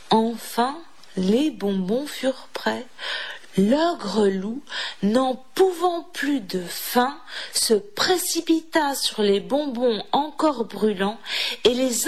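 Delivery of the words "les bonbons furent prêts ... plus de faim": ordinary delivery, nothing marked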